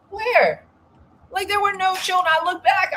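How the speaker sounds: incredulous outburst. Real, not acted.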